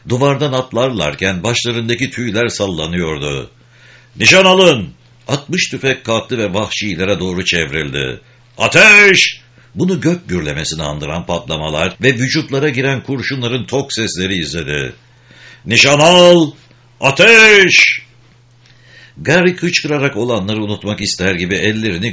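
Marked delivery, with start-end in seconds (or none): 4.1-4.9 shouted command. Acted. Sergeant readying the riflemen, shouting at full voice. 8.6-9.4 very high, explosive yell; shouted firing order. Acted. Sergeant ordering the riflemen to fire, shouting. 15.6-18.0 very high, explosive yell; shouted firing order. Acted. Sergeant ordering the riflemen to fire, shouting.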